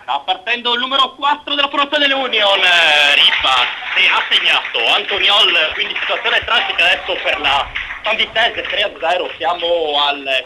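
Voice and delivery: excited phone match report, quick and loud; live play-by-play. Real, not acted. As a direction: call the goal like a radio reporter. (radiocronaca concitata)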